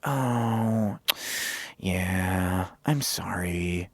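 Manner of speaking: low, soft, earnest mock condolence; drawn-out, insincere concern; fake sympathy. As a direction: drop down into a super serious, hushed sympathy voice. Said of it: "fake sympathy voice people do".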